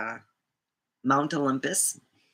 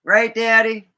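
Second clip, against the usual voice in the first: calling out; 5 semitones higher, 8 dB louder than usual. Real, not acted. Calling out to Daddy.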